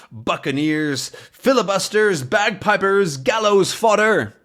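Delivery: captain hurling a string of insults, mock fury; building insults. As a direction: build each insult bigger like a raging captain. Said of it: "A bunch more insults".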